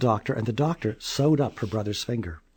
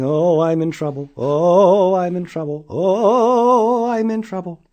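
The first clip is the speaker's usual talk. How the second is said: worried sing-song; 5 semitones higher, 5 dB louder, slower than usual. A narrator's reading. Child worried heading home.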